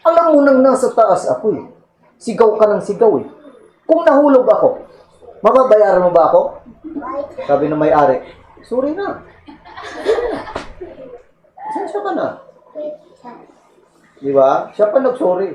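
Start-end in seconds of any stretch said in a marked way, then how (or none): 0.1-6.4 high, fast indignant scolding; indignant thief. Retold or imitated. Thief scolds the owner for shouting, high indignant rant. 8.6-12.3 high, soft pleading sorry; meek apology. Retold or imitated. Owner meekly apologizes to the thief, soft and high.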